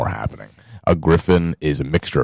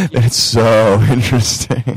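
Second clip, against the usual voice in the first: laughing, slow and higher; laughing mockery; 8 semitones higher, 5 dB louder, slower than usual. Real, not acted. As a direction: laugh through the words mockingly. Laughing, mocking "so interesting.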